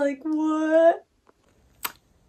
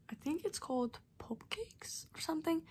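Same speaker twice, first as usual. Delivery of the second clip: whisper; 5 semitones higher, 16 dB quieter than usual. Real, not acted. Whispering, eating Pop Cakes.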